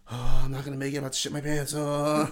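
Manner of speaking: loud, panicky moan, acted; mock freaking out. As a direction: imitate a man freaking out in desperation. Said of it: "like, freaking out".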